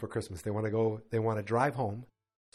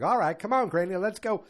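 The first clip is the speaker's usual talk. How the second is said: louder, higher retold summons; calling out; 7 semitones higher, 5 dB louder than usual. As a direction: imitate rounding up the family to leave. Jed rounds up Granny to leave.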